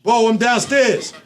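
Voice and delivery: calling out, high and slow; yelling across the room. Real, not acted. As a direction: shout it to someone in another room. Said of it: "Hold on, my man downstairs real quick".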